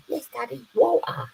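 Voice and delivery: very high mock southern English daughter; daughter's southern English accent. Dad imitating his daughter calling him, high southern English accent. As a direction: squeak it like a London-sounding little daughter. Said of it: "She's got a southern accent".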